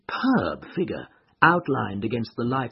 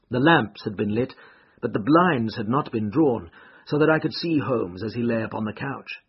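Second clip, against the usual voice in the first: plain reading voice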